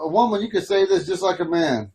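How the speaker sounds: ordinary talk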